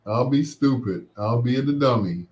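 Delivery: ordinary talk, nothing marked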